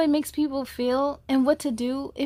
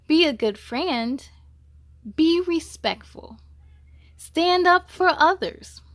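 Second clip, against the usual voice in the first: pledge; 6 dB louder than usual. Shown as a demonstration. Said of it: "let's take Ava's and Mia's pledge".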